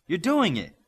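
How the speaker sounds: thrilled for him; excited encouragement. A narrator's reading. Darkspeed cheers Midas on as the boat moves, excited.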